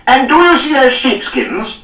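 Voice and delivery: high, loud, slow bellow of rage; bawling. A narrator's reading. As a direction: bellow his name across the yard. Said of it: "bawled Cardigan".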